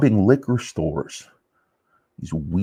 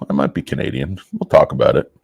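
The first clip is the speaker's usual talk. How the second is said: normal talking voice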